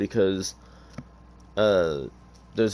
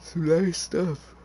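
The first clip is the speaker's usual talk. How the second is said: talking through a yawn, high and drawn out; slow, high yawn-talk; 11 semitones higher, slower than usual. Real, not acted. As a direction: let a real yawn stretch the words. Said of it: "Sorry if I'm yawning".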